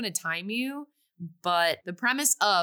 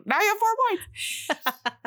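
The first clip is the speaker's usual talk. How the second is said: mock announcement; 13 semitones higher than usual. Host jokes about the score.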